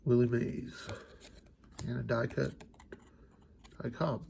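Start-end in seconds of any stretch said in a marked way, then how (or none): none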